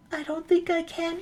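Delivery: high, soft and unsure; small timid voice. Retold or imitated. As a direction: squeak it out shyly. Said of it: "He's like".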